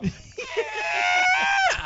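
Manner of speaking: high shrieking witch cackle; evil cackle. Pretend. Spooky cackle.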